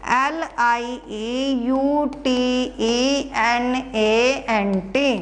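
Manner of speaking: letters spelled out slowly one by one; slow spelling out. Shown as a demonstration. Teacher spelling lieutenant for students, slow and deliberate. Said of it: "उसके बाद lieutenant".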